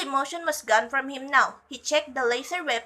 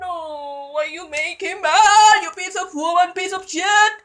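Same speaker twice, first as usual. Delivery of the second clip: mock-angry fan wail; 6 semitones higher, 6 dB louder than usual. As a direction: wail it in high, hammed-up protest. Reader rants in jest at the character.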